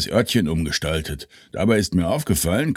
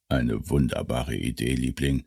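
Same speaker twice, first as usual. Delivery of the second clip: low doting voice; 8 semitones lower, 7 dB quieter than usual. Acted. Couple dialogue, low soft.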